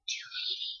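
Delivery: breathy hushed whisper of a price; barely voiced, as if sharing a secret. The cashier whispering the price to the girls. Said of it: "like, whispering".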